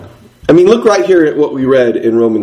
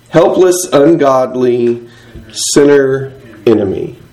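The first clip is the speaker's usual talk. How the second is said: normal talking voice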